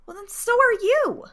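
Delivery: put-on high, loud child's retort; teen yelling at the scolding midwife; defiant shout. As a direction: fling it back at her.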